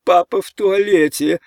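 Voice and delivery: high, loud wail; shrieky, loud pleading voice; frightened child's cry. A narrator's reading. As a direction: cry out like a panicking little boy. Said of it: "закричал Ричард".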